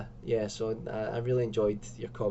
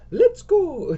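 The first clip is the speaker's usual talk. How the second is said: very high, loud cartoon cry; Mario; 12 dB louder than usual. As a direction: shout it high like a game hero. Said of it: "She's turned into Mario".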